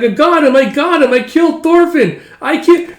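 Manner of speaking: put-on very high, loud kid's boast; triumphant high yelling; excited crowing. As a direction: shriek the victory like an excited child. Child crows over a play kill.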